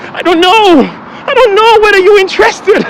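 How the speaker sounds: high, whining fake cry; mock whiny wail. Teasing viewers who haven't subscribed.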